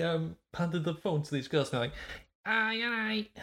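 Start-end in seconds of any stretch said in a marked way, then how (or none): 2.4-3.3 high-pitched mock Scouse; Liverpool accent. Storyteller mimics girls handed the phone, high Liverpool accent. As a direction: imitate a Liverpool girl, pitched way up. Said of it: "I think he was in Liverpool somewhere".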